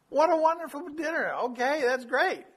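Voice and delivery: playfully overexcited reply; acted delight. Acting delight at the supper promise.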